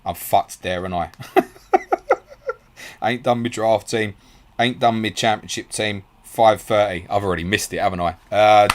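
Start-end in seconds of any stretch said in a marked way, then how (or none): 1.7-2.5 very high wheezy laugh; helpless laugh. Real, not acted. Host realises he forgot his teams, helpless high laugh.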